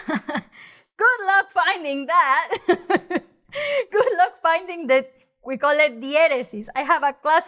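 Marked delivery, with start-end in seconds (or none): 0.1-3.2 slow words through laughter; laughing. Real, not acted. Jokes about finding dots online. 3.9-5.1 amused, higher, through a laugh. Real, not acted. Repeats the joke, laughing.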